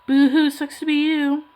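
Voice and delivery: taunting boo-hoo; mock crying boo-hoo. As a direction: tease them with a fake sob. Mocking viewers who overpaid.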